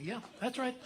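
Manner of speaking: normal talking voice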